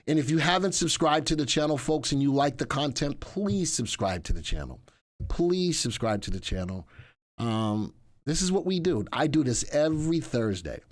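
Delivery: ordinary talk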